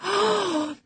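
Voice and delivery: sharp, high intake of breath; shocked gasp. A narrator's reading. Housekeeper hears talk of the squire's funeral, horrified gasp. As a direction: gasp in horror. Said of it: "I gasped".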